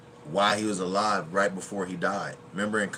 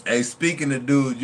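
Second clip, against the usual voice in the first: usual speaking voice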